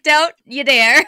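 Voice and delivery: loud, emphatic telling-off; mock warning. Co-host retelling her warning to the other host, loud mock scolding. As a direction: blurt a forceful warning over laughter.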